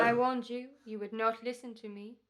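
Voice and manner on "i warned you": much louder menacing shout; furious shout. Acted. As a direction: roar it as you strike. Killer attacks with knife.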